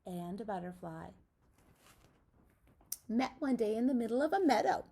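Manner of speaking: ordinary delivery, nothing marked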